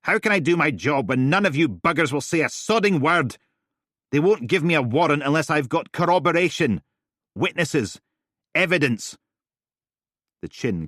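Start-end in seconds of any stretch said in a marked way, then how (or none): 0.0-9.2 loud, high, furious rant. Acted. Steele ranting at Helen, loud and furious. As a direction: vent it all, jabbing every phrase. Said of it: "arms jabbing away for emphasis".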